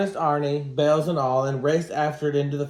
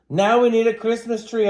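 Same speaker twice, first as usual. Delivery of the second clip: high, loud, bright-eyed instruction; eager announcement; 8 semitones higher, 7 dB louder than usual. A narrator's reading. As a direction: announce the next job eagerly. Child narrator eagerly instructing trolls.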